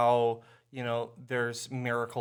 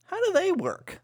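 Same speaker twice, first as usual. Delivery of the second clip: high squeaky quote. Pretend. Quoting [name] lyric, high.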